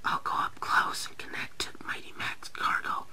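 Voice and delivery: voiceless, secretive whisper. A narrator's reading. Billy plots quietly with Duncan, whispering. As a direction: whisper the plan. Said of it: "whispered Billy".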